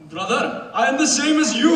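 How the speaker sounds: mock lonely Indian accent; Indian accent pleading. Pleading to hung-up caller.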